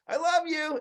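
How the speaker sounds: high-pitched, offhand call without real volume; mock yell. Husband calling to wife while leaving, mock yell. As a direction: imitate calling out a routine goodbye. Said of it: "I yell to my wife".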